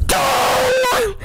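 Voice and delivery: high, booming shouted chime. Acted. The clock booming its loudest. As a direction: bellow it like a huge bell.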